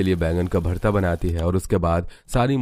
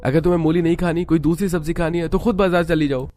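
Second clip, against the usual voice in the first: high-pitched acted reprimand; indignant scolding; 9 semitones higher than usual. Mother-in-law rebukes Sheela for her tone, high-pitched scolding. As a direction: pitch climbs as you tell her off.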